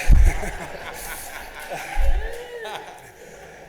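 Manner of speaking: a long, high burst of laughter; real laugh. Real, not acted. After joking that no is French for no, laughing. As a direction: laugh hard at your own joke. Laughing at his own joke.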